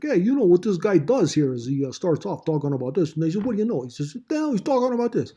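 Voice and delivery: put-on high, loud New York accent; playful New York accent paraphrase. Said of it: "you can do it like in a New York accent".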